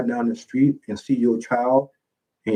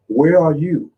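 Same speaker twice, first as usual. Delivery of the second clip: ordinary talk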